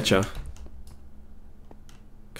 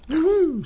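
very high whoop at normal volume; quick high-pitched cheer; high woohoo. Real, not acted. Player hit a baby mob, high whoop.

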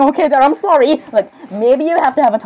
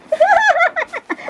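very high-pitched cackle; loud character laugh. Acted. Dad laughing too loud beside Charlie, high cackle. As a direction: burst into a high, silly laugh. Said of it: "why are you laughing?".

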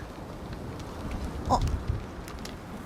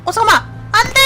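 panicked cry; 9 semitones higher, 6 dB louder, much faster than usual. Real, not acted. Player panics at game jump.

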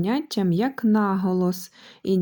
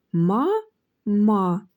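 "Ма, ма" split by a clear pause; two detached syllables, "Ма" then "ма". Shown as a demonstration. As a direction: say each "ма" separately, evenly. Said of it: "складається з двох складів".

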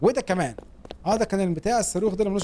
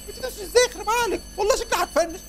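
high-pitched panicky squeal; alarmed, voice shot way up; panicked; 13 semitones higher than usual. Real, not acted. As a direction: squeal as you lose control in the game. Panicking as the game goes wrong.

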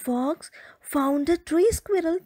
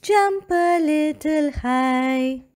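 slow coaxing call; 3 semitones higher, 5 dB louder, slower than usual. Acted. Urging the fox.

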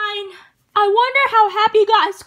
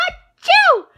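high sneeze shouted out; 13 semitones higher than usual. Acted. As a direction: let a big sneeze burst out. Can't stop sneezing.

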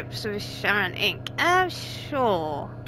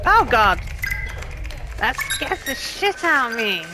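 startled yell; 2 semitones higher than usual. Real, not acted. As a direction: react to a jump scare. Jump scare in game.

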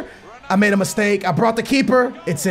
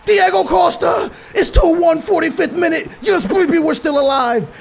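excited goal shout; 6 semitones higher than usual. Real, not acted. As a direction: roar the comeback goal. Late goal keeps him alive.

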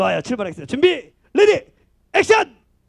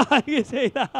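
okay said through a laugh; 3 semitones lower, 7 dB quieter than usual. Real, not acted. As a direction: crack up while saying okay. MC laughing at the boy's answer. (웃으면서 말하는 알겠습니다)

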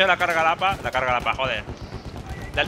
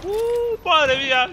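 high, loud startled gasp; surprised exclamation; 14 semitones higher, 4 dB louder, much slower than usual. Real, not acted. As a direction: yelp it out in shock. Reacción a una muerte. (sorpresa exclamada, aguda)

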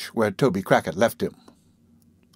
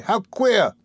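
higher, mildly wondering exclamation; reflective; 9 semitones higher than usual. A narrator's reading. Noah puzzled by Charlotte's fondness, mildly reflective. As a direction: muse aloud with a rising lift in pitch. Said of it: "said Noah reflectively".

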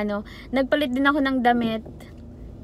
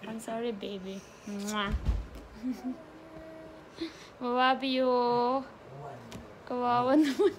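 tender soothing; 8 dB quieter than usual. Real, not acted. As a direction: coo gently to comfort. Soothing the hurt baby. (malambing na pag-aalo)

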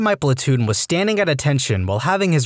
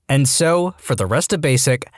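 ordinary delivery

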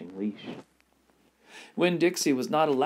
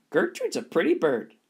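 higher, louder mock parrot cry; parrot squawk; 6 semitones higher, 4 dB louder than usual. The parrot praising itself in the shop, squawking. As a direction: squawk it like a bird. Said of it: "screamed Gertrude".

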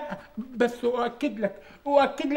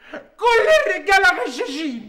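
slow, louder tearful wail; slow and loud, a sob in every word, all men are cheats; crying out; 4 semitones higher, 7 dB louder, slower than usual. Acted. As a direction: wail it through tears. Heartbroken man complains about Hanan.

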